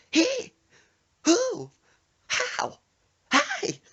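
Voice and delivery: rhythmic, very high loud yelps. Retold or imitated. Passenger yelps on every bump, rhythmic squeals. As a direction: squeal on each beat like a funk singer. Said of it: "like I was riding with [name]".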